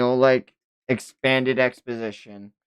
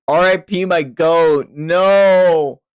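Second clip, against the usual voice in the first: loud dismay; 7 semitones higher, 9 dB louder, much slower than usual. Real, not acted. Mourning a favourite character.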